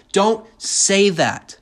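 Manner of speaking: slow, loud mock scold. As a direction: drag it out like a preachy scold. Voicing a scolding judger.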